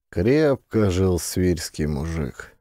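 plain reading voice

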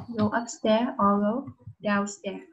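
slow, careful "Go upstairs or go downstairs."; slow model phrase. Shown as a demonstration. Teacher drills the phrase for the pupil, slowly. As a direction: spell out each word for a child to repeat. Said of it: "Can you understand?".

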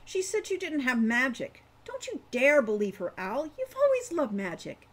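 put-on don't you dare, pushed hard by the young girl; fierce child Wren. A narrator's reading.

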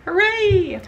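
high, loud happy cheer. Real, not acted. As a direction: shout with joy at praise. Cheers at praise.

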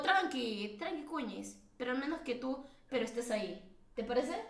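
very soft, coaxing reassurance; gently calm her down, voice dropped low in volume. Real, not acted. Reassures her about slow wifi.

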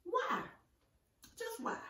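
ordinary talk

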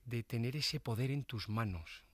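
ordinary talk, nothing marked

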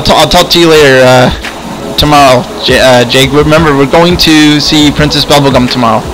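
usual speaking voice

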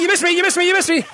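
usual speaking voice

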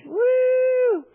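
high drawn-out woo; ecstatic whoop. Real, not acted. Preacher overcome by God's love, ecstatic whoop.

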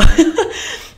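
risita corta y sin voz tras el comentario; risa breve. Real, not acted. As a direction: suelta una risita rápida y aireada. (risa ahogada después de decir "¿cierto?")

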